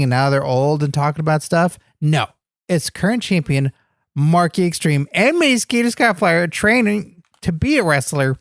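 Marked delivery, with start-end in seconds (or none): none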